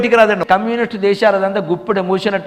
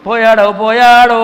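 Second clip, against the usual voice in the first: drawn-out, louder mock wail; mock lament; 3 semitones higher, 7 dB louder, slower than usual. Mourners over the man who waited to shoot, mock wailing. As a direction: stretch it out like mourners announcing a death.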